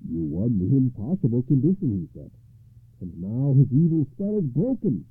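admiring knight. Acted. Gawain admiring.

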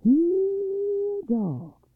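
high, long-drawn call for the dogs; loud, high-pitched calling out; loud holler. A narrator's reading. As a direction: holler out to summon faraway dogs. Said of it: "he hollered loud".

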